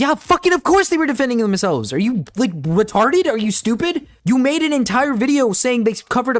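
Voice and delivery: outraged, incredulous comeback; exasperated outrage. Real, not acted. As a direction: fire back in angry disbelief. Angry rebuttal of the video.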